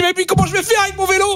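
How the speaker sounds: high, exasperated yell; mock shout. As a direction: cry out in hammed-up despair. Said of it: "Je dis".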